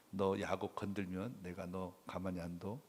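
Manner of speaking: low, soft menacing warning; a quiet, firm threat; stern God threat. Pretend. God threatening Laban, quiet stern warning. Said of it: "실질적으로는".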